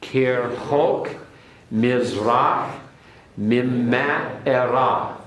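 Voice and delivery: slow, careful Hebrew, each word set apart; slow teacher's Hebrew recitation; Hebrew read aloud. Shown as a demonstration. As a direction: model the Hebrew for students to copy. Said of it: "everyone repeat it".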